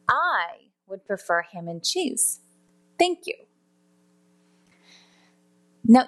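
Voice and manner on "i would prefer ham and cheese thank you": "I" drawn out long at the start; "I" emphasized and set apart from the rest; stress on I. Shown as a demonstration. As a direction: lean on the first word "I", stretching it. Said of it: "use this example to emphasize certain words".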